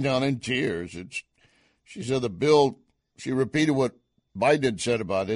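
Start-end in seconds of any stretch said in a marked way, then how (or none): none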